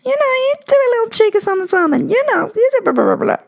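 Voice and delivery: high, quick teasing voice; cheeky put-on voice.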